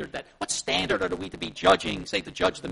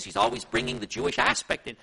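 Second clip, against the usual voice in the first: ordinary delivery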